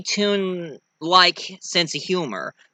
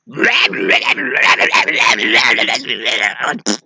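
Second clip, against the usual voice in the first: Tasmanian Devil gibberish; 6 semitones lower, 4 dB louder than usual. Retold or imitated. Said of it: "Tasmanian devil".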